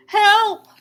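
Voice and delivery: HELP yelled high and loud; panicked cry. Acted. Splat chasing the runaway pumpkin, panicked yell. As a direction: scream for help as it runs away. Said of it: "Splat cried".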